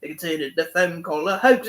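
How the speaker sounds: lightly sung in a hint of Cockney accent; a touch of Cockney sing-along; mock Cockney. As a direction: hum it with a faint Cockney accent. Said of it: "singing in this really heavy Cockney accent".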